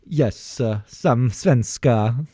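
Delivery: slow, bouncing Swedish lilt; exaggerated slow Swedish singsong; Swedish accent. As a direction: copy a train-rhythm Swedish accent. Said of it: "how you do a Swedish accent".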